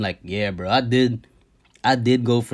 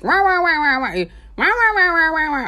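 high, nasal-free mock wah wah babble in a much higher voice. Mocking the other driver's talk as meaningless wah wah. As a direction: make his words into empty high wah wah noise. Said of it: "That's what it sounded like to me".

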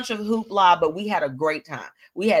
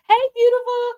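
much higher and louder squeal of hello; light up and greet her loudly; excited greeting; 7 dB louder than usual. Real, not acted. Greeting a viewer in the chat, high and excited.

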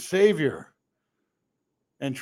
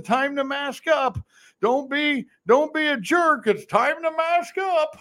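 mocking scold; 8 semitones higher than usual. Mocking mask mandate scolds.

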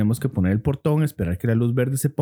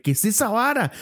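startled; 8 semitones higher than usual. Retold or imitated. Retelling his startled question.

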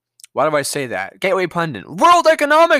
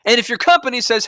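ordinary talk

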